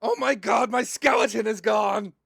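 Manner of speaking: panicked shout. Acted. Taki finding his skeleton gone, shouting in panic. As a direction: yell like your bones just vanished.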